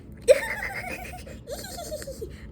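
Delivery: very high, thin giggly squeal; nervous squeal imitation. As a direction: squeal like a nervous woman around her crush. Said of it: "she's, like, all, like".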